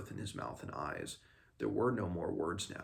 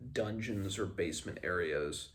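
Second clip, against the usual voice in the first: usual speaking voice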